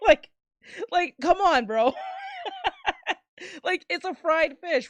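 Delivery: breaking into high laughter mid-sentence; laughing. Real, not acted. Streamer to chat about catfish, laughing in disbelief.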